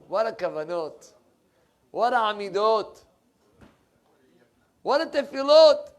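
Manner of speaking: exclamations building to a high shout; showy mock admiration. Mimicking self-admiring showy prayer.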